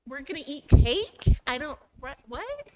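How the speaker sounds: playing a student lost in the idiom; confused student. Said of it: "some of our students coming in are like".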